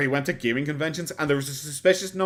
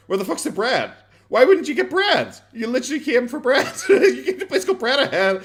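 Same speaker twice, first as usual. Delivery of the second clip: much higher and louder, laughing disbelief; incredulous outburst; 10 semitones higher, 5 dB louder than usual. Real, not acted. Streamer mocks friend for skipping bread, high laughing outburst. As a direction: shriek in amused disbelief. Mocking friend's food order.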